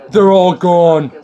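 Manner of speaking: high, slow, drawn-out Trump voice; Trump impression. Mocking Trump to a friend, slow high drawl. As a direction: ham up Trump's sad boast.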